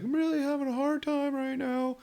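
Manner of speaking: high, mocking whimper of a crying coworker; mock crying nurse. Mocking the crying nurse at shift end, high whiny voice. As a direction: whine it out like a mocking sob story. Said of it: "but she's just like".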